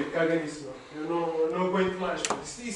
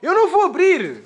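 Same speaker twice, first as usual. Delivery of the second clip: rising higher and louder with each "não vou abrir"; refuse, getting shriller each time; scared refusal; 7 semitones higher, 7 dB louder than usual. Real, not acted. Friend refusing to open the door, higher and louder scared protest.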